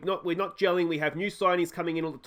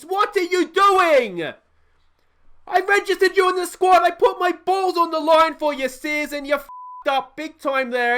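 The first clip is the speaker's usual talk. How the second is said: furious rant; 10 semitones higher, 9 dB louder than usual. Real, not acted. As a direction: rage at your useless defenders. Concedes, rants at players.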